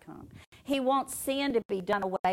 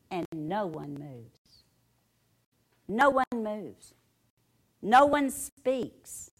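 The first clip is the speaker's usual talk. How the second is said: slow, spaced, weighty lines; dramatic pauses; slower than usual. Acted. Preacher to congregation, slow solemn pauses. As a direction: let each no one hang in silence.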